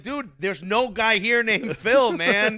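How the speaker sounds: high, loud, drawn-out protest; mock exasperation. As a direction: plead loudly as a fed-up onlooker. Acts out everyone confronting the friend.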